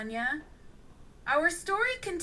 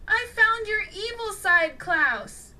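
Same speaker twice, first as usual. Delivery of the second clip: higher and louder, eager; bursting with the news of a find; excited; 9 semitones higher, 7 dB louder than usual. A narrator's reading. Dylan tells his friend Klaus, excited and louder. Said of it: "Dylan became excited".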